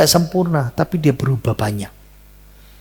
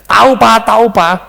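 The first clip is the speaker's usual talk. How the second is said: put-on eager child reply; 7 semitones higher, 8 dB louder than usual. Anak menjawab ayah cepat.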